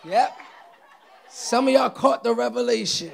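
loud, high shout to the crowd; excited preaching. Real, not acted. Preacher to those who caught the revelation, excited shouting. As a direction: crow to those who got it.

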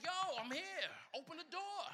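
high, loud call through the door. Retold or imitated. Peter at the locked door, loud impatient call. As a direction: bang on the door and yell to be let in. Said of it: "Peter's like".

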